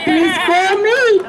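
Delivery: normal talking voice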